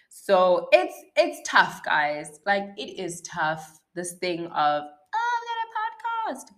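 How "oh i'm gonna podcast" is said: mock naive voice. Mocking her naive past self.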